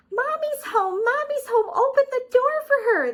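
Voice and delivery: very high, loud happy squeals; little bunnies cheering for mom; excited bunnies. Acted. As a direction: cry out with joy. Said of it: "they cried".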